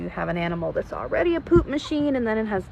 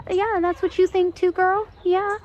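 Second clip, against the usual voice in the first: cooing pet voice; 11 semitones higher, 3 dB louder than usual. Real, not acted. Cooing to the animals, high pitched.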